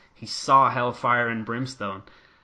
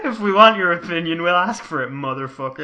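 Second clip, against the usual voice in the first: mock angry rant; 6 semitones higher, 8 dB louder than usual. Mock scolding Russo with co-host.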